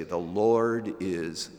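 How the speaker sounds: quoted prayer. Acted. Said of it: "I want to be able to say".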